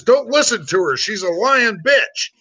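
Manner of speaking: high-pitched rancher cutting in; mock-alarmed rancher's warning; urgent acted protest. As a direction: hammer the punchline, slowing at the end. Rancher's panicked punchline in joke.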